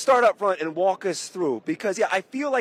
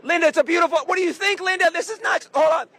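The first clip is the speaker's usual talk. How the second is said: high, eager calls to the owner; excited; 9 semitones higher than usual. Real, not acted. Host calls out to the owner Linda, high and excited. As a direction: pull her in with excitement.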